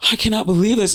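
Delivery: high-pitched mock-tearful disbelief; retold crying. Alone in the car afterwards, tearful. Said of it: "I'm crying and I'm like".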